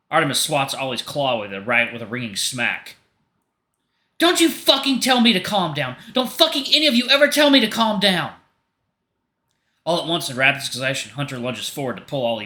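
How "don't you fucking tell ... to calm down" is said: high, loud, far-off-voiced rage; furious tirade. Artemis refusing to calm down, high furious shout. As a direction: rage at all of them at once.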